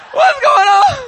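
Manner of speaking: usual speaking voice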